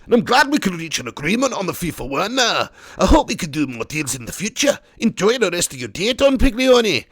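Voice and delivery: put-on a light Scottish accent on the agent's lines. Agent closing the deal with Don Piglioni, lightly Scottish. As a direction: read the agent's reply in a mild Scottish accent. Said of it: "Suddenly I'm Scottish".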